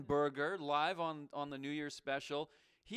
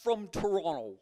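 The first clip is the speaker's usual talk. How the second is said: mocking echo; 6 semitones higher, 8 dB louder than usual. Host mockingly echoes the caller's denial.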